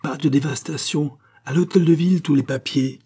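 high, rising call to action; crowd shout. Acted. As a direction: shout the order as one of the mob. Crowd calls out agreement.

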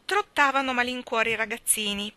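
plain reading voice

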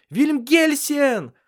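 very high, loud, drawn-out call; shouting through house. A narrator's reading. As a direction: call someone from across the house, stretching the name. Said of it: "слышался по всему дому её крик". (громкий протяжный крик на весь дом)

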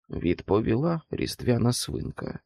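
usual speaking voice

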